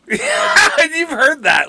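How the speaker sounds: laughing out the words, high; squeezed through laughter; giggling through it. Real, not acted.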